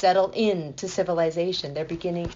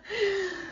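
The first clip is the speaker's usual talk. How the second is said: high giggle; 12 semitones higher, 6 dB quieter than usual. Real, not acted. After cartoon quote.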